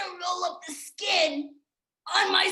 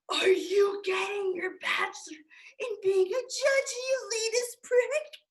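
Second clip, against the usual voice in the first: ordinary talk, nothing marked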